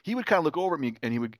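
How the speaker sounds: ordinary talk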